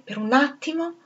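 ordinary delivery, nothing marked

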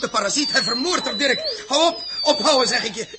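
alarmed shout. Acted. Captain shouting at Dirk to stop, frantic. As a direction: shout at them to stop now.

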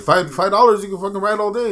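hammed-up vendor pitch; mock street hawker. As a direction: hawk cheap rides to passersby. Acting Guida hawking bull rides.

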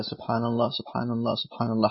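a soft, counted repetition of سبحان الله; softly repeating dhikr. Shown as a demonstration. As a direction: repeat it quietly as if counting on your fingers. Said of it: "أم يقول هكذا".

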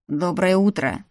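a sullen, grudging good morning; gloomy greeting. A narrator's reading. Salier greets her gloomily at breakfast, sullen. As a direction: mutter it like a frown. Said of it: "хмуро произнёс Сальер".

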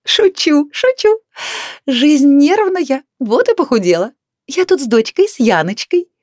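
high, loud, jolly chatter; brash chatty neighbour. A narrator's reading. Neighbour introduces daughter to couple, brash chattering. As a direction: gush noisily like a brash acquaintance.

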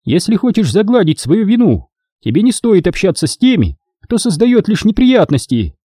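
lightly voiced stern matron; stern warning. A narrator's reading. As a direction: warn him off sharply. Obin warning Davi.

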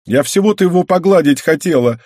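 high, loud offended whine; whiny sulky girl. Acted. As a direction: whine it like a wronged kid. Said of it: "проныла та обиженно".

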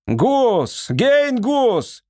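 high summoning cry; calling out. A narrator's reading. Lord summons Гейн Гус from afar, calling out. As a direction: call his name out urgently, pitched high. Lord calls out for his wizard.

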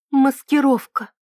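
plain reading voice

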